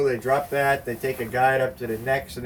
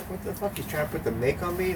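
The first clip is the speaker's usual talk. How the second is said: baffled disbelief; 7 semitones higher, 5 dB quieter than usual. Retold or imitated. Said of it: "Ram Dass is there going like".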